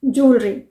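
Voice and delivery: ज्वेलरी as two syllables, "jool-ree"; long oo and no e in ज्वेलरी; correct pronunciation. Shown as a demonstration. Teacher confirms the right way, ज्वेलरी as jool-ree. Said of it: "सही pronounce करते हैं".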